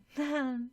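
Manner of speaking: a strained polite laugh; forced laugh. Acted. Girl smiling forcibly at her rival, forced laugh. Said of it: "Smiling forcibly".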